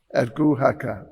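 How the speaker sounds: ordinary delivery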